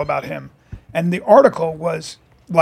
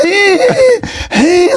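high, strained gasping hey; gasping for breath; 13 semitones higher, 3 dB louder than usual. Retold or imitated. The actor hit in the elbow who couldn't breathe, gasping high. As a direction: squeeze it out as if winded. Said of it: "He goes".

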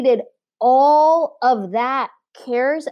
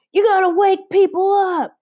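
homeless guy yelling; 9 semitones higher than usual. Retold or imitated. Homeless man outside Starbucks scolding a screaming woman, mock yelling. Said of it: "yelling to her, telling her, like".

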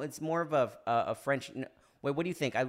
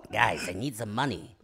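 dropping low, sheepish plea; lower-pitched drunken begging; hammered Michael Caine; 5 semitones lower than usual. Acted. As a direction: wheedle it down low after the shouting. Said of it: "Then he calls the Russian mob".

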